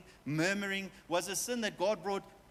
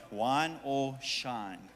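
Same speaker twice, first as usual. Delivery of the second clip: Wine or shine stretched very slowly; slow, deliberate title; slow emphasis; 5 semitones lower, much slower than usual. Shown as a demonstration. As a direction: draw out "Wine or shine" word by word. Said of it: "Not wine, W I N E".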